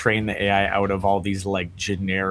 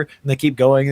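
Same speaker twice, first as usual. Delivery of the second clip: usual speaking voice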